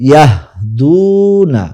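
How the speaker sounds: ইয়াহদুনা very slow, with a loud drawn-out DUU; long waw vowel. Shown as a demonstration. Teacher asking students to note the masculine, long DUU in ইয়াহদুনা. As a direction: stretch the DU in ইয়াহদুনা right out. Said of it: "খুব ভালোভাবে আবার লক্ষ্য করুন".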